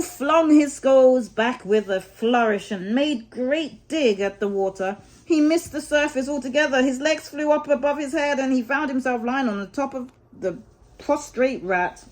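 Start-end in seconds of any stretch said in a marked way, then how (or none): none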